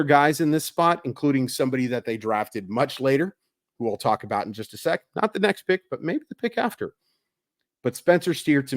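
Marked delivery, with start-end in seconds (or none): none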